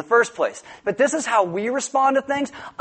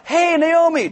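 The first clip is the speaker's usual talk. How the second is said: cheery greeting; 8 semitones higher, 4 dB louder, slower than usual. Retold or imitated. People cheerfully greeting Naomi.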